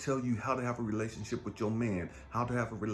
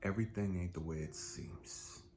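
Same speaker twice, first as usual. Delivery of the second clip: God speaking softly; 5 semitones lower, 5 dB quieter than usual. Pretend. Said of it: "I can hear God say".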